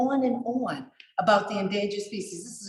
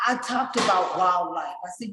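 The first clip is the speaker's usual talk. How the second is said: ordinary delivery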